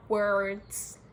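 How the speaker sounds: WORDS in an American accent, hard R drawn through it. Shown as a demonstration. Mocking people who think they turned American, American-accented WORDS. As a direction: say "words" the American way, R fully sounded. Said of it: "I don't speak like that".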